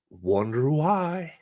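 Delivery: usual speaking voice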